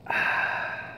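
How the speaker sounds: a breathy, satisfied "ah" after a sip; coffee sigh imitation. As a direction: imitate a coworker's contented exhale after coffee. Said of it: "he'd go".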